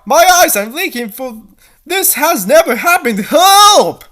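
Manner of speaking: high, rising to a loud cry for help; panicked. Acted. Dee Dee startled by her own tears, climbing to a loud high cry. Dee Dee crying for first time.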